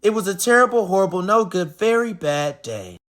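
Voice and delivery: ordinary delivery, nothing marked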